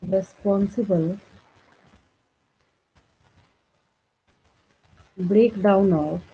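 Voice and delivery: slow, measured read-out for note-takers; dictation. Real, not acted. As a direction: dictate word by word, leaving long gaps. Dictates notes while writing.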